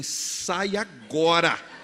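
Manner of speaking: slow, guttural mock growl of an angry husband. Husband growling at his wife blocking the TV, guttural. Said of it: "num tom gutural".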